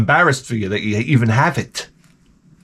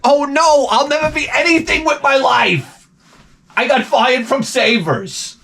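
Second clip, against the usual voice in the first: mock despair; 10 semitones higher than usual. Mock despair about being fired.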